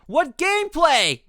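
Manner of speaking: real exasperated streamer; drawn-out disbelief. Real, not acted. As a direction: stretch it out in disbelief. Stunned at short demo.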